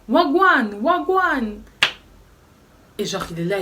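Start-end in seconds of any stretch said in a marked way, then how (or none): 0.1-1.6 high yelled greeting, a bit less full; retold yell. Flatmate keeps yelling at the narrator, high and loud. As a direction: shout it again, slightly worn. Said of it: "qu'elle criait H vingt-quatre".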